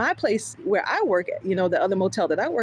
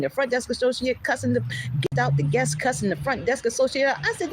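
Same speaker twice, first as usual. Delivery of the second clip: ordinary delivery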